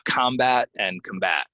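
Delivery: "combat" as COM-bat, then com-BAT; contrasting stress. Shown as a demonstration. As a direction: move the stress from first to second syllable of "combat". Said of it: "Here's another one".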